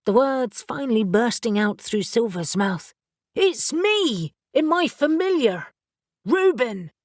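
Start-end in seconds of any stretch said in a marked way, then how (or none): 3.3-6.9 urgent yell. A narrator's reading. Reuben yelling to Neil.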